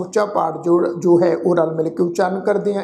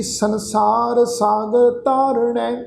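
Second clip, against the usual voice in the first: scripture recitation; 2 semitones higher, much slower than usual. Acted. Chanted verse line.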